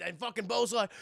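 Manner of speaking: ordinary talk, nothing marked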